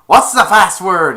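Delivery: loud, high challenge; mock tough-guy shout; gruff demand. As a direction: bar thug taunting through the door.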